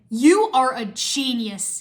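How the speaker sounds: slow, loud, emphatic praise; exclaiming. A narrator's reading. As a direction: draw out each word in delight. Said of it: "he exclaimed".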